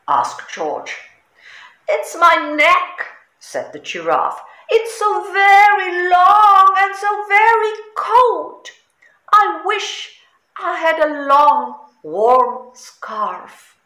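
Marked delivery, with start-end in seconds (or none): 1.9-3.1 much higher, louder giraffe voice; a sniffly, sorry giraffe; sad giraffe. A narrator's reading. Cold giraffe telling George her trouble, plaintive. 4.7-13.7 much higher, louder giraffe voice; a sniffly, sorry giraffe; sad giraffe. A narrator's reading. Cold giraffe telling George her trouble, plaintive.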